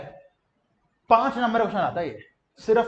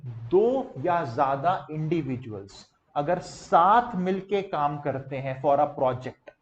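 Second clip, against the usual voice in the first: slow, lower-pitched teacher's definition; slow, deliberate dictation in a lowered pitch; measured definition reading; 5 semitones lower, slower than usual. Real, not acted. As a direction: spell out the definition slowly. Teacher slowly reciting a definition.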